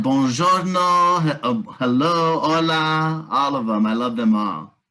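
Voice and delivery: ordinary delivery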